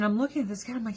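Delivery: normal talking voice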